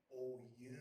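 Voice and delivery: low, drawn-out wrestler growl; eighties wrestler. Acted. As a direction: answer like a puffed-up eighties wrestler.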